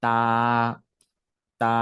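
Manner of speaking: তা slightly drawn out, tongue tip on the gum ridge; তা, lengthened. Shown as a demonstration. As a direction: stretch তা a little, tongue behind the teeth. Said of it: "এভাবে ছেড়ে দিব".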